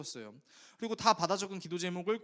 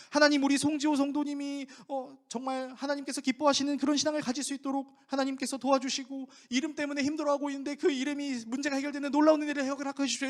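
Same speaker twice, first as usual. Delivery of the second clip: loud, higher, crisp rapid-fire prayer; loud fast prayer; 9 semitones higher, 7 dB louder than usual. Shown as a demonstration. Young preacher praying loudly for all to hear. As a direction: pray out loud, every syllable crisp and clear. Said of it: "예를 들면은".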